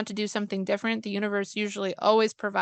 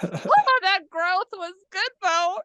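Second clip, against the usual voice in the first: much higher, bright mock-chipper voice, drawn out; mock cheerful self; 10 semitones higher, 4 dB louder, slower than usual. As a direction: play your past self, overly pleased and slow. Her own reaction mock-cheered.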